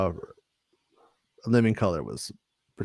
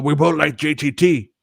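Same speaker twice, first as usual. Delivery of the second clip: higher-pitched cartoon teen impression; Beavis; 3 semitones higher than usual. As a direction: imitate a friend stuck in a cartoon voice. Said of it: "who talked like Beavis forever".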